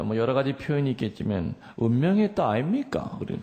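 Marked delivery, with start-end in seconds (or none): 1.8-3.1 high, swinging Gyeongsang intonation; high-pitched Gyeongsang dialect question. Shown as a demonstration. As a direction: put on a punchy Gyeongsang accent. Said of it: "경상도 말로 뭐라 그럽니까?".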